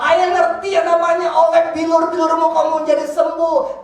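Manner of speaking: loud, high, fervent preaching; real shouted declaration of faith; triumphant proclamation. Real, not acted. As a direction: proclaim it at full voice with drawn-out emphasis. Proclaims his faith at full force.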